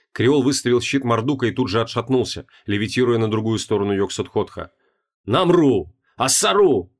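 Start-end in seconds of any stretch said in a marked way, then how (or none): 5.3-6.9 louder sharp spell cries; shouted out. Acted. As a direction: bark the names out. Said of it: "выкрикнул он".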